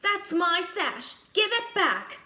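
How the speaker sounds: high, loud demanding yell; snatch it back, shouting; angry shout. Acted. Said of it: "shouted Anastasia".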